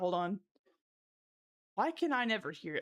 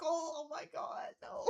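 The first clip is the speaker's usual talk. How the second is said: soft, slow laugh-cry under the breath; words squeezed out mid-giggle; 4 semitones higher, 6 dB quieter than usual. Real, not acted. Streamer wheezing at chat, soft laughing.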